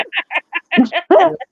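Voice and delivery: high laugh. Real, not acted. Laughing at the story.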